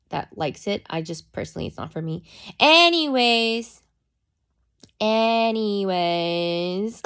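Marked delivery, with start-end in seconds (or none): 2.6-3.8 high, loud, stretched ANYWAYS; emphatic topic change. Real, not acted. Streamer getting back to her story, high emphatic Anyways. 5.0-7.0 ANYWAYS stretched very long, rising at the end; exaggerated drawn-out segue. Real, not acted. Streamer comically returning to her story, very drawn-out Anyways. Same word stretched further, then laughs.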